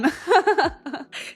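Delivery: a high, sheepish laugh; embarrassed laugh. Real, not acted. Admitting her binge day, a sheepish high laugh.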